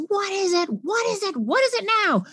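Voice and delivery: high, impatient repeated demand; irritated parent. Retold or imitated. Parent charging upstairs at a crying child, irritated. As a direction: snap it out, fed up and rushing. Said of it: "we come charging up the stairs".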